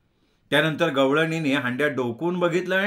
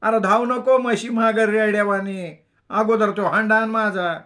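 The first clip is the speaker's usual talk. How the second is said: high, loud rustic command; ordering milkmaid; 7 semitones higher, 5 dB louder than usual. A narrator's reading. The milkmaid orders the narrator to fetch her pot first. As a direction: bark the order at him. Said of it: "तिने हुकूम सोडला".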